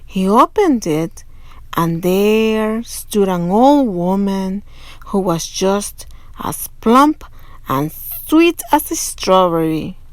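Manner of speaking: usual speaking voice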